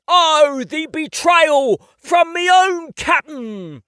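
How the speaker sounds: loud, slow, hammed-up English pirate wail; mock betrayal. Playing pirate with a child, hammy English betrayal. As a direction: overplay the betrayal in a bad English accent. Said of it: "I gasp".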